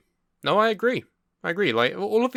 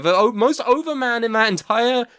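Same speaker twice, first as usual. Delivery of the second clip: much higher, loud, exasperated; outraged rant; 9 semitones higher, 4 dB louder than usual. Real, not acted. Fan ranting to Andy about the booking, high and exasperated. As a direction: vent at the bookers who fucked it up.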